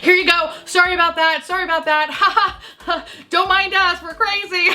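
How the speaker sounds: high, squeaky nervous laugh-apology; overly bright embarrassed apology; apologizing to the driver, awkward high laugh. Retold or imitated.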